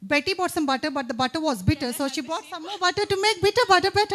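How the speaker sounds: brisk, quick tongue twister run; fast demo. Shown as a demonstration. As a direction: zip through it to show the pace. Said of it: "it should be fast".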